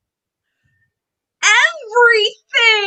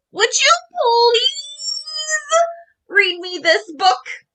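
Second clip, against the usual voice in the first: sweet plea; 8 semitones higher, slower than usual. A narrator's reading. Dragon asks the raccoon.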